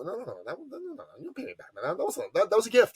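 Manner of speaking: a hammed-up dad waving off repayment, pitch jumping way up; imitating dad. Dad refusing a friend's repayment, high and fast. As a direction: brush off the thanks in a squeaky, fast protest. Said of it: "He talked like that".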